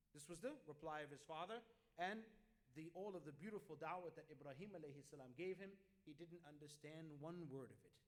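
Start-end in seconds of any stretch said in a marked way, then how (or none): none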